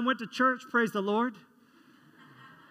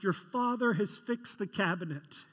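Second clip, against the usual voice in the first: ordinary talk, nothing marked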